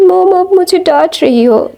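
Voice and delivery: high, whiny complaint to mom; whiny son. Retold or imitated. Son whining to his scolding mom, high and pouty.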